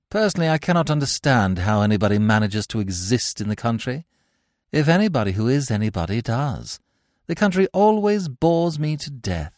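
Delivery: rising, languid disdain; bored snob. Acted. Gwendolen mocking country life. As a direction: sound utterly bored.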